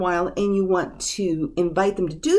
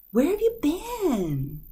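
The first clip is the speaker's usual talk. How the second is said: caring question; 8 semitones higher, slower than usual. Shown as a demonstration. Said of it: "you wanna make that connection again".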